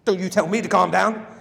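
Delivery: loud, indignant snap back; indignant retort. Shown as a demonstration. Trainer acting the angry reply to "calm down", loud. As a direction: fire it back, offended. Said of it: "what do you say back?".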